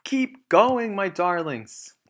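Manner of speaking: breathless urging; panting. A narrator's reading. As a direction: pant the words out. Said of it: "panted Mister Fox".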